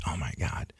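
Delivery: breathless whispered disbelief; stunned whisper. Retold or imitated. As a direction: barely voice it, stunned. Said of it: "And I'm like".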